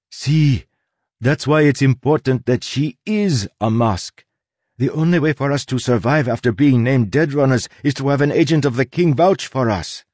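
insistent Soleil. Acted. Soleil argues to the crew that Tress is a mask, insistent. Voices Soleil arguing her case.

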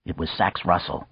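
plain reading voice

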